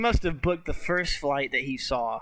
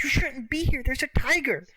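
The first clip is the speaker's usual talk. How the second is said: boss's warning pitched higher and a touch urgent; mock alarmed boss; 9 semitones higher than usual. Retelling the boss warning Roy, mock alarmed and higher. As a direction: play the boss shooing the kid off, voice up high. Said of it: "And the boss is like".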